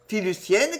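ordinary delivery, nothing marked

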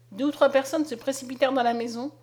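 plain reading voice

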